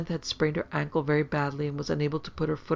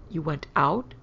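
mildly shocked echo, rising at the end; shocked question. A narrator's reading. Mother to disobedient daughter, mildly shocked. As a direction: question it in disbelief.

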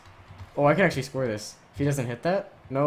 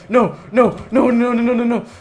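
rising to a long high scream; repeated loud cries of no, building; panicked shouting; 11 semitones higher, 13 dB louder, much slower than usual. Real, not acted. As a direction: yell in mounting gamer panic. Losing control of the play.

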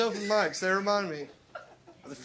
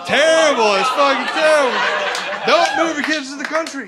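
loud, laughing declaration; laughing shout; 4 semitones higher, 11 dB louder than usual. Real, not acted. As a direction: belt it over the laughter. Big laugh then shouted verdict.

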